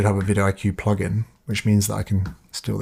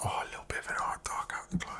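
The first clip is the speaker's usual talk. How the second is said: whispered musing to himself; hushed whisper; 16 dB quieter, faster than usual. Real, not acted. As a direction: breathe the words out as you tinker. Musing over Photoshop effect.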